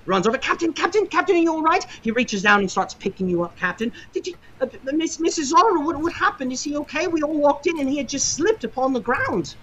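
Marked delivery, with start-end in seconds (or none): 0.4-1.8 put-on high, anxious young boy's voice; worried cabin boy. Cabin boy kneels by the fallen captain, high and anxious. 4.1-9.5 put-on high, anxious young boy's voice; worried cabin boy. Cabin boy kneels by the fallen captain, high and anxious.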